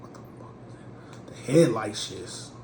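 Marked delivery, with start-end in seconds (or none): 0.0-1.3 fast stunned whisper; awed whisper. Retold or imitated. Seeing a champagne Maybach roll up, stunned whisper. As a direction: hush it in disbelief.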